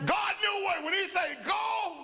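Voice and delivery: high, loud preaching cry; preaching shout. Real, not acted. Pastor shouting to the congregation about obeying God. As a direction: lift it into a full-force shout.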